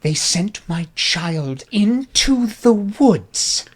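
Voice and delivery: high, drawn-out appalled lament; theatrical outrage. Acted. Sire learns their child went camping, theatrical outrage. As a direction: lament it slowly, scandalized on a child's behalf.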